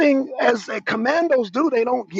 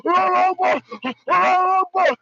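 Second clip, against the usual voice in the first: higher chanted yell; mock battle cry; 6 semitones higher than usual. Mocking fighters in videos, higher mock chant. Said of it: "all the motherfuckers".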